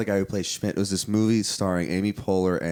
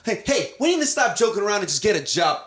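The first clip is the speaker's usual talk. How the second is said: mock earnest brother; 11 semitones higher, 4 dB louder than usual. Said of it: "it's like".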